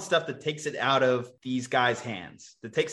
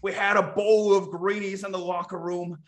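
put-on old man voice; 5 semitones higher, 3 dB louder than usual. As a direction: reminisce like a cranky old-timer. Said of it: "old man impersonation".

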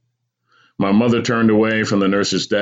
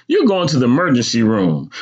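higher, firm scolding; snapping mother; 7 semitones higher, 4 dB louder than usual. Acted. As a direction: lay down the law to the boy. Mother snaps her decision.

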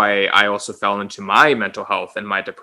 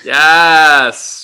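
excited cheer; 5 semitones higher, 9 dB louder than usual. Real, not acted. Cheering on guest's closing words.

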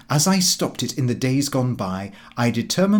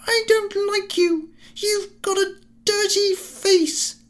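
put-on very high, slow child's voice. A narrator's reading. A child insulting the gentleman, high and slow. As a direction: voice a rude little child.

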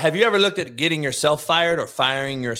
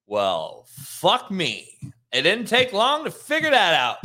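ordinary talk, nothing marked

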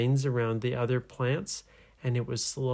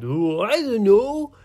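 high, loud pirate holler; clueless pirate calling from above; yelling down; 9 semitones higher, 8 dB louder than usual. Acted. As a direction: holler it down in a comic squeal. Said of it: "And the pirate yelled down".

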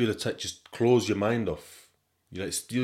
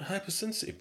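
high, softer questioning echo; incredulous echo; 13 semitones higher, 5 dB quieter than usual. Retold or imitated. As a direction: repeat it high, in mock disbelief. Retells his baffled reaction.

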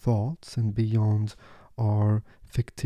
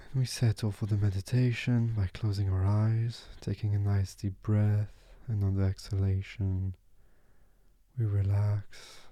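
guided meditation. Acted.

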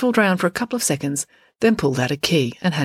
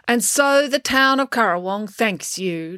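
slow, higher-pitched speech that drones on; droning mayor; 5 semitones higher than usual. A narrator's reading. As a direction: drone it out like a long-winded mayor. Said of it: "droned Mayor Albright".

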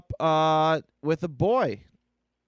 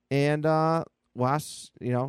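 ordinary talk, nothing marked

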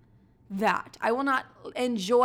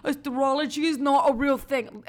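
higher, louder mock skeptic; louder mocking voice of a doubter; mocking skeptic guy; 4 semitones higher, 3 dB louder than usual. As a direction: parody a smug guy dismissing astrology. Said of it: "when you're like".

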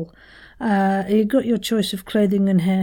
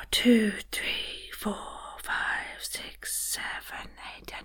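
whispered exhale, fading out; slow, airy voiceless counting; deflating count; 11 dB quieter, slower than usual. Shown as a demonstration. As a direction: count on one long escaping breath. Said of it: "Someone's let all the air out".

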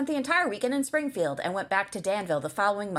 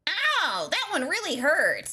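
high-pitched cartoon boy; Bart Simpson; 7 semitones higher, slower than usual. Shown as a demonstration. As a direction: put on a bratty cartoon kid. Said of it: "Just like Bart".

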